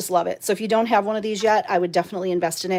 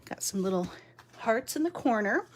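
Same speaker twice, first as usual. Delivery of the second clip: normal talking voice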